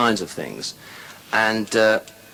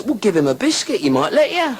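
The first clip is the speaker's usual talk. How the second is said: mate's punchline; 10 semitones higher than usual. Acted. Mate's punchline about the dog.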